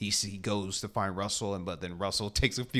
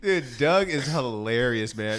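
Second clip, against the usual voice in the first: ordinary delivery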